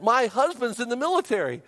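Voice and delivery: high-pitched worry; retold panic. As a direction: imitate an anxious wife. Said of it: "she was just panicked".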